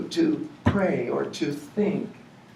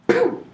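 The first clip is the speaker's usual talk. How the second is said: gunshot noise; 7 dB louder than usual. Retold or imitated. Imitating a rifle shot.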